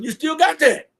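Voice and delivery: worked-up retold advice. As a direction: hammer home that nothing changed. Retelling his blunt advice to cellie.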